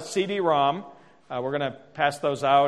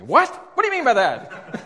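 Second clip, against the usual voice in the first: high, loud defensive protest; mock indignant; 9 semitones higher, 6 dB louder than usual. Husband reacts to wife's criticism, hammed-up indignation. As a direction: overreact as if affronted.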